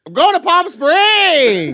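high, slow, drawn-out shout of joy; excited cheer. Real, not acted. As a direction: sing it out like a big announcement. Excited announcement of trip.

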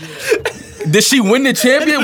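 high, laughing disbelief; shocked laughter. Real, not acted.

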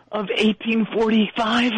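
ordinary delivery

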